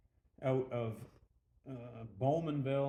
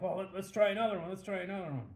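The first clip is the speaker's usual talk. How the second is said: higher, eager retold plea; excited urging; 6 semitones higher, faster than usual. Bar patrons ask for another test, excited. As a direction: imitate a crowd keen for another round.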